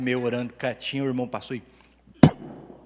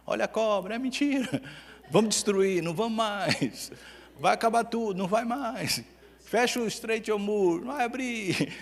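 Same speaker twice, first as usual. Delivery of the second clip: high, mock square-dance caller; mocking the news as a quadrilha call; 8 semitones higher than usual. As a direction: call it out like a festa junina announcer. Mocking the news like festa junina quadrilha.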